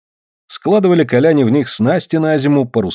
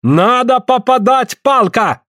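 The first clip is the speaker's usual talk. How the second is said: high, slow exasperated cry; shouted complaint; 12 semitones higher, slower than usual. A narrator's reading. Suль scolds over the missed stick, shouting high. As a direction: call it out high, stretching the words. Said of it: "кричит Суль Афанасию".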